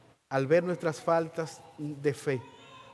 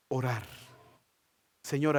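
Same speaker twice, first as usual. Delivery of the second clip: ordinary delivery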